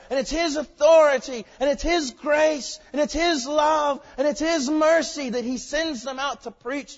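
loud, high, driving sermon cadence; full-force preaching. Real, not acted. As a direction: hammer out each item with rising fervor.

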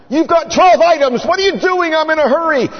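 high, loud, huffy complaint; impatient shopper. Acted. Shopper in the ten-item line snaps at the customer, high and loud.

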